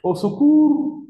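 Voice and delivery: high, slow, loud hammed-up cry for help; mock cry for help. Teacher jokingly cries out to the class, a hammed-up cry for help. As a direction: pretend to be drowning in the question.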